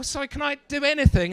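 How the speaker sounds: usual speaking voice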